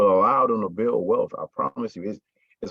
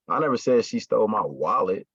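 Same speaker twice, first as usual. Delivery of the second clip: "wallet" punched at the end; stress on WALLET. Shown as a demonstration. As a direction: hit the last word, "wallet". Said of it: "meaning it wasn't my wallet".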